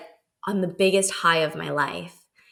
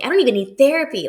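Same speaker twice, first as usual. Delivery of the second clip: giddy elation; 7 semitones higher, 6 dB louder, faster than usual. Retold or imitated. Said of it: "being like".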